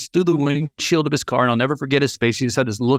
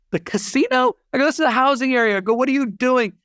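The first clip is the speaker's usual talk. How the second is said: put-on much higher, baffled child voice; confused kid; 12 semitones higher than usual. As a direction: squeak it out in disbelief. Kid shocked dad drove to housing.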